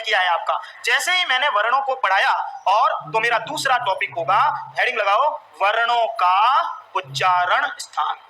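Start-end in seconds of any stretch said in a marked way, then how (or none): 5.6-8.2 measured, drawn-out words for note-takers; slow dictation. Real, not acted. Teacher giving students a heading to write, slow dictation. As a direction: dictate the heading slowly, stretched out.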